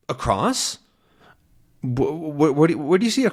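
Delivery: lightly voiced puzzled professor, stammering; a hint of a befuddled professor; confused old professor. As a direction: stumble over it like a confused old man. Deaf Calculus voiced, confused.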